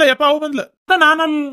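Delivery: repeated high frightened cry, falling; panicked alarm. Acted. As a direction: warn in a fright, still pitched high. Man panics as wife arrives.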